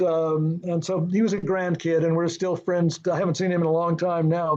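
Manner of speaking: ordinary talk, nothing marked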